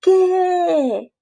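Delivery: put-on high kid's what; high answer. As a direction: answer back like a child. Froggy responde a la llamada. (respuesta aguda de niño)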